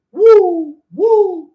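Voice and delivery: high, loud imitated bird hoot; bird call. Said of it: "certain birds".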